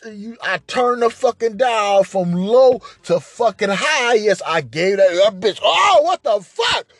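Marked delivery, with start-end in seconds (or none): 5.6-6.9 yelling quarrel. Retold or imitated. The woman and he yelling at each other, angry shouts. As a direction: scream back at her.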